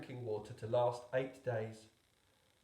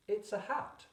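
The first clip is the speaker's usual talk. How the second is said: lightly voiced grown-up, a bit higher; a hint of a bored adult brushing it off; dismissive grown-up; 7 semitones higher than usual. A narrator's reading. Grown-ups answering the boy's drawing, lightly voiced bored adult. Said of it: "but they always answered".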